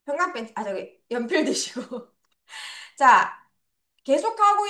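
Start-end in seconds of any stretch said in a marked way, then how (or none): none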